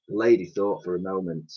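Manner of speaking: ordinary delivery, nothing marked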